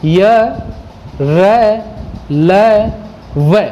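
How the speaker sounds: य, र, ल, व each sounded alone with the breath held inside; semivowel demonstration. Shown as a demonstration. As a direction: say "य, र, ल, व" one at a time, drawn out with pauses between. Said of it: "श्वास अंदर का अंदर ही रह जाता".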